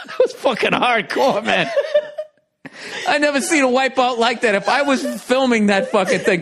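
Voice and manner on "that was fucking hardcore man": laughing. Real, not acted. Laughing while praising wipeout.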